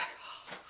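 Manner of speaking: sharp breathless gasp for air. Shown as a demonstration. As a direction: gasp like after running. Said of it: "you're breathing hard".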